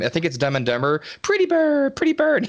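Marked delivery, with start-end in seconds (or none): 1.2-2.5 high squawky parrot voice. Retold or imitated. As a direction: imitate a talking parrot, pitched way up. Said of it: "It's like Petey from".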